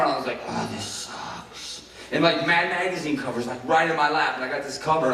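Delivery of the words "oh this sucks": slow, softer, dragging; sulky grumble at the storyboard job; deflated groan. Retold or imitated. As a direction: moan it with boredom. Stuck with boring job.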